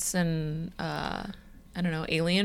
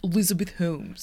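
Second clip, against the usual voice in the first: throaty put-on founder voice; Elizabeth Holmes. Host demonstrating the impression for her co-host, throaty put-on voice. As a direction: pull the name back in your throat. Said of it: "You do such a good".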